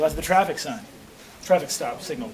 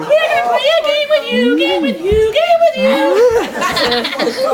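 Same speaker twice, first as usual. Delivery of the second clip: very high, taunting clown voice; evil clown; 13 semitones higher, slower than usual. Acted. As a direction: draw it out in a shrieking singsong.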